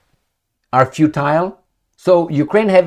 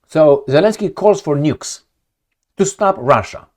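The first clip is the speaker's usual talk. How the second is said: normal talking voice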